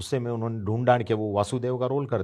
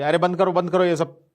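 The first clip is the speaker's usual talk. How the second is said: much higher, louder, fed-up shouting; angry shout; 8 semitones higher, 7 dB louder than usual. Retold or imitated. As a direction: stop the whole thing, exasperated. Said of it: "तो गुस्सा हो गए".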